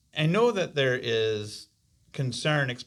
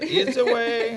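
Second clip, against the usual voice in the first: fake German accent; 11 semitones higher, 4 dB louder, slower than usual. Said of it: "that's my best Freud voice".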